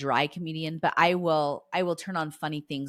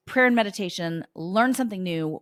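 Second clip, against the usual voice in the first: usual speaking voice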